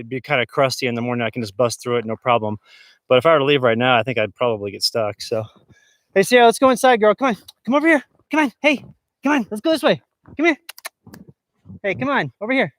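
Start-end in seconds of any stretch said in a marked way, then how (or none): 6.1-12.7 high-pitched, playful pet-calling voice; calling the dog. Real, not acted. Owner calling the dog to go inside, high coaxing. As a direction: coax a dog over in short, high calls.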